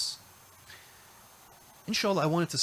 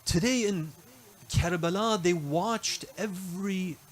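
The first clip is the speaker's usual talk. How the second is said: lamenting recitation; 5 semitones higher than usual. Acted. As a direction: draw the listeners into the mourning, unhurried. Shifts into mourning narration of Karbala.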